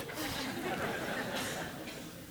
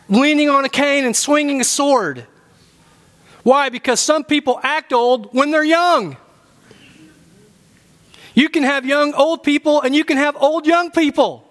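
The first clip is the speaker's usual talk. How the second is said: raised, high-pitched preaching voice; emphatic preaching; 8 semitones higher than usual. Real, not acted. Preacher urging church to stay young, emphatic. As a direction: drive the point home, pitched well up.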